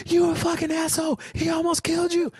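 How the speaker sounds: high, fast retold scolding; retold screaming. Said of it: "classic New York style screaming fest".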